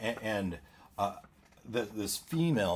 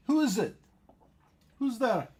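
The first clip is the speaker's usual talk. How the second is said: much higher, wary woman; 12 semitones higher than usual. Acted. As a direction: call through the door, high. GM voices woman at door.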